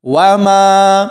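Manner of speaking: وما slowly, with a long held final aa; stretched madd. Shown as a demonstration. Teacher joining meem to alif, vowel stretched. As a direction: draw out the maa of وما on a level tone. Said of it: "کھینچ کر پڑھا جائے گا".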